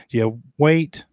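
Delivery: ordinary talk, nothing marked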